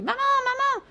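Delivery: put-on high, loud child's cry to mother; calling out. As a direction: shout up to the window for mom. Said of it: "se mit à crier".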